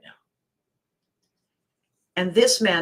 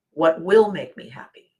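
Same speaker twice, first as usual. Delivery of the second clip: "will" leaned on, louder, then falling; stress on will; 5 dB louder than usual. Shown as a demonstration. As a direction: put the emphasis on "will". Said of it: "Emphasis on will".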